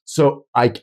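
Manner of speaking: ordinary delivery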